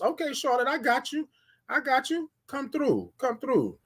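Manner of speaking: high, soft, teasing sing-song; high playful reply. Real, not acted. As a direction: coo it back sweetly up high.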